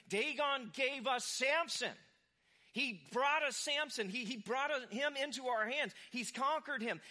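loud, slow, high gloating chant; triumphant crowd cheer. Retold or imitated. Philistines praising their god, loud triumphant cheer. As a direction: crow over the captured enemy. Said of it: "it's like".

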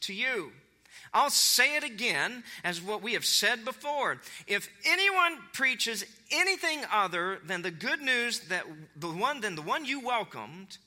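solemn, emphatic apostolic warning; impassioned scripture reading. Acted. Reads Paul's curse aloud.

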